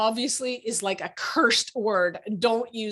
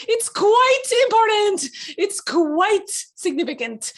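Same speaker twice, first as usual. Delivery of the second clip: pompous mock; 10 semitones higher, 6 dB louder, slower than usual. Shown as a demonstration. Mocking pompous word quite.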